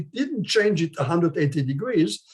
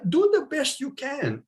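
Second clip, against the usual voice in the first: encouraging; 8 semitones higher than usual. Real, not acted. Coaxing shy volunteers to speak.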